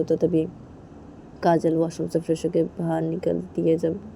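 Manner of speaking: ordinary delivery, nothing marked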